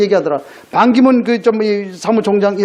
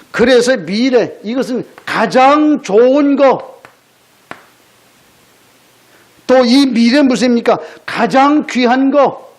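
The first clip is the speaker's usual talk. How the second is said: slow emphasis; slower than usual. Real, not acted. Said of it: "preacher slows for emphasis".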